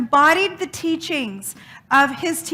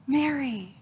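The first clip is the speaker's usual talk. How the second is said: gentle call; 5 dB quieter than usual. Acted. The risen Jesus calls her name.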